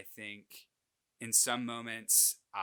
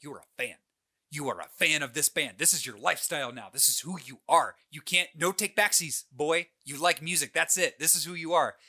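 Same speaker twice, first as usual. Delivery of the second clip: louder, higher mom declaring it; insistent mom; 4 semitones higher, 7 dB louder than usual. Retold or imitated. Mom insisting her son is a band fan, louder. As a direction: lay down the law to your boy. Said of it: "my mom being like".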